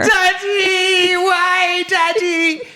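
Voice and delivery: very high, wailing mock-child plea; panicked child. Girl with a bird in her hair calling her dad, mock panic. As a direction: squeal for help like a panicking kid, hammed up.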